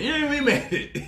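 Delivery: high, slow, laughing "Yeah, we made it."; gleeful laughing boast. Shown as a demonstration. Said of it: "you know what I mean when I say".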